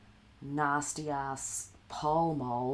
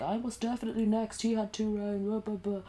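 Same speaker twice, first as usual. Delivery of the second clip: mock Jaron; 7 semitones higher than usual. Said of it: "he'd be fighting with the person".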